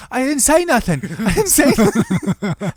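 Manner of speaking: high laughing protest of innocence; mock innocent protest. Friend imitating the arrested heckler, high laughing denial. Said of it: "He's like".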